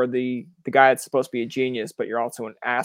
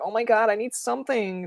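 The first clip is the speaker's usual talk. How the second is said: put-on high, whining kid voice, pitched way up; whiny little kid; 9 semitones higher than usual. Mocking Canadians as little kids begging for an identity, whiny child voice. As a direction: whine it like a needy child. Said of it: "we're like little kids".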